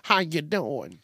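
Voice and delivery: playful Southern twang greeting; exaggerated twang. Shown as a demonstration. Host showing his little accent to listeners, twangy greeting. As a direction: lay on the country twang. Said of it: "I have a little accent sometimes".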